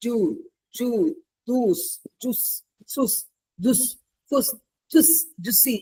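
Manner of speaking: "juice" tried as ju, sus, dus, fus, jus, juicy, one short try at a time; toddler's mispronunciations. Shown as a demonstration. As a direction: imitate a toddler's attempts at "juice", each variant separated by a pause. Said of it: "pronounced juice as".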